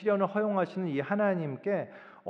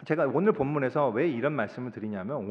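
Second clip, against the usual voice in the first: normal talking voice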